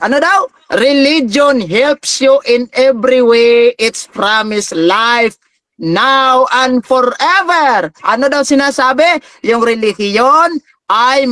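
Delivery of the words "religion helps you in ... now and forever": slow scripture reading. Acted. Reading Bible verse aloud.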